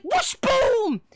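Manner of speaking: comic battle noises. Acted. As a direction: mimic the blast. Replays the friend's moves.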